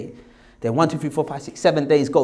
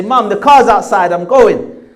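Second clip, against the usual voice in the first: loud, raised call to mum; 4 semitones higher, 10 dB louder, slower than usual. Retold or imitated. Son leaving for the car, calling out to his mum. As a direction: shout it through the house on leaving.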